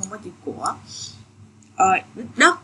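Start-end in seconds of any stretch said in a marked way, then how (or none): 0.0-0.7 whispered aside "Không có chữ của". Real, not acted. Teacher whispering a quick aside to students. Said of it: "aside to students".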